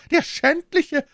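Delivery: high, loud exclamation; indignant cry. A narrator's reading. Milady hears of the ring, crying out. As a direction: cry out in outrage, voice leaping up. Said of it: "rief Milady".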